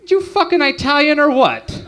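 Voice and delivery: dad's voice pitched high and odd; mock dad. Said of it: "Your dad would be like".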